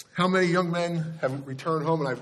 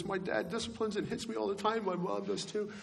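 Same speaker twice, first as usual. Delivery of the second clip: mock sobbing boy; 7 semitones higher, 7 dB quieter than usual. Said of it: "just sobbing and crying".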